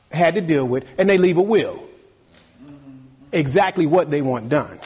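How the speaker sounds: usual speaking voice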